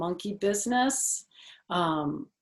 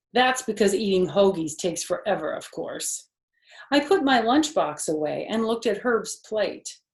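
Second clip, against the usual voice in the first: lively read-aloud narration, louder; story narration; 3 semitones higher, 6 dB louder than usual. A narrator's reading. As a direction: tell it like a bedtime storybook.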